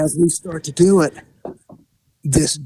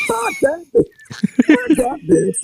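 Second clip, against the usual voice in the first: very high comic voice; mock eager agreement. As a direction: switch sides in a squeaky falsetto. Acted turning against the woman.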